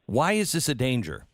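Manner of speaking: ordinary delivery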